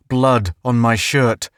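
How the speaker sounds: usual speaking voice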